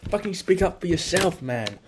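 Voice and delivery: building to a full yell; self pep shout. Real, not acted. Yelling at himself to speak up and attack, building. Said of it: "When was the last time you spoke up".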